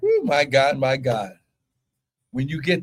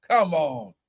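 usual speaking voice

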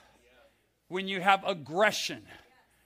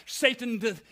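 normal talking voice

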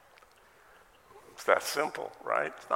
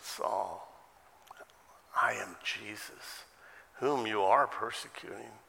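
calm, compassionate correction; gentle, without anger; slower than usual. Retold or imitated. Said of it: "No, he said".

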